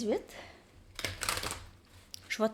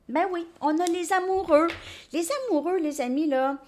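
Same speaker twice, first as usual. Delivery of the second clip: excited; 7 semitones higher, 5 dB louder than usual. Real, not acted. Delighted the lovers card appears.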